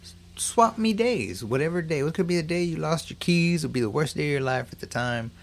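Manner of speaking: high, sly, wheedling Rumpelstiltskin voice; weaselly salesman pitch. Retold or imitated. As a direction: sweet-talk him like a conman imp. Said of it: "weasels up to him and tells him".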